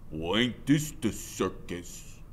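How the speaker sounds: slow, rough mocking drawl; menacing biker. Biker sneering at two kids on his doorstep. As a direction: sneer it out slowly. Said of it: "he says, spitting a big gob".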